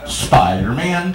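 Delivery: slow deadpan reveal. Acted. Punchline said to the partner.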